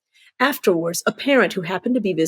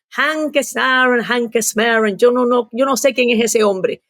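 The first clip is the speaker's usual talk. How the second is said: higher, accented mock mother's complaint; scolding mother; 4 semitones higher than usual. Daughter retelling her mother's phone complaint, higher mock scolding voice.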